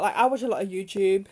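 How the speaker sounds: ordinary talk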